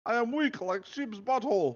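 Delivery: high pleading fake Russian accent; hammed-up Russian accent. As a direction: beg the commander in a thick hammed-up Russian accent. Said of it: "proud of what I did with the Russian accent".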